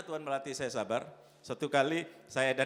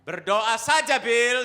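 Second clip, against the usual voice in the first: loud retort; 7 semitones higher, 14 dB louder, much slower than usual. Retold or imitated. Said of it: "saya bilang".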